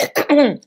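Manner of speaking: real throat clear, loud and choked. Real, not acted. Host clears her throat while holding back tears. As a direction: clear a tight, teary throat. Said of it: "That's why I keep clearing my throat".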